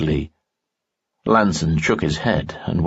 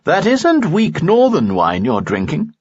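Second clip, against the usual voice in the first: higher, playful warning; Lanson, higher teasing voice; 9 semitones higher than usual. A narrator's reading. Friend teases Lee about his wine, higher voice.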